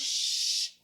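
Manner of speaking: long hissed "shh"; teacher demo of a drawn-out "shh"; sh sound. Shown as a demonstration. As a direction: push out a long steady "shh". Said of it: "if I'm saying an S H sound".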